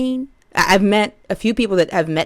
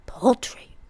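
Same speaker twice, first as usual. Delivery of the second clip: whispered, mocking the word Paltry; a soft unvoiced Paltry; 3 semitones higher, 4 dB quieter than usual. Real, not acted. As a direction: breathe out Paltry like a secret. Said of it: "whispering the big word".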